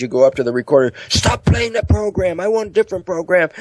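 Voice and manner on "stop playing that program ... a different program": high, strained yell without real volume; hammed-up demanding shout, held back; mock yelling. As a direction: pretend to yell orders at a machine. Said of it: "you keep talking and yelling at the recorder".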